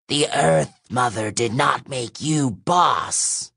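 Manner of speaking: slow, strained monster screech; shrieking monster. A narrator's reading. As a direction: protest the new boss. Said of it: "shrieked a fourth voice".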